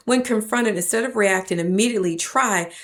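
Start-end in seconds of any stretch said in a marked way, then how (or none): none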